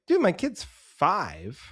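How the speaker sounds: slow, drawn-out exasperated complaint. Retold or imitated. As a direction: spell it out, fed up. Exasperated retort at long show.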